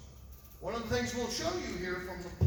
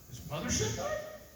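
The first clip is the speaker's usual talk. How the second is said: incredulous; 14 semitones higher than usual. Retold or imitated. Investigator quoting his own disbelief.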